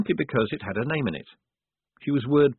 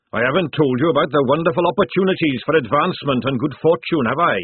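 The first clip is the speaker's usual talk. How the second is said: loud, booming fat sergeant; hearty sergeant; 6 semitones higher, 6 dB louder than usual. Acted. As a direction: boom it like a jovial recruiter. Sergeant pitch.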